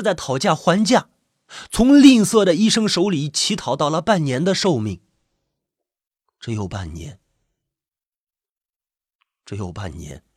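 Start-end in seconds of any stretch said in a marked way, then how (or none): 6.4-10.2 low, hushed echo to himself; numb, quiet repetition; hushed disbelief. A narrator's reading. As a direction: let it sink in, very softly. Patient absorbing his six-month prognosis.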